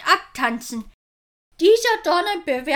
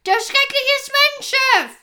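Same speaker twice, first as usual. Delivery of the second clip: alarmed cry; 11 semitones higher, 3 dB louder than usual. Acted. Lookout warning of the enemy.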